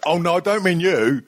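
high mock apologetic bloke. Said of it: "he'll go".

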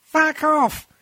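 high-pitched put-on voice firing back; screech-pitched comic refusal, rushing at the end; mock rude partner. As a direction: answer in a silly high voice as the partner. Acts out Shell's rude reply.

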